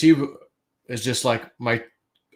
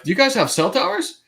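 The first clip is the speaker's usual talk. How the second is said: high-pitched mock questioning; retold incredulous question; 12 semitones higher than usual. Retold puzzled question in his dream.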